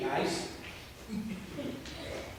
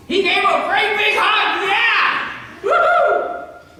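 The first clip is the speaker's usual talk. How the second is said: much higher and louder, cheering the hug; joyful cheer; 11 semitones higher, 6 dB louder, slower than usual. Real, not acted. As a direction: let it burst out like a party. Joyful climax for the children.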